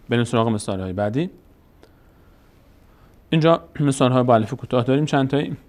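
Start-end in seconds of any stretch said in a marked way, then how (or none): none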